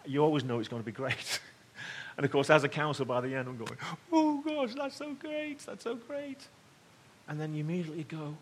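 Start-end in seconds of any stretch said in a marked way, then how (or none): 4.1-6.4 high, tearful voice breaking on the words; choked-up crying. Retold or imitated. Pastor retelling how he wept at the movie ending, tearful and high. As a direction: relive being moved to tears, voice cracking high.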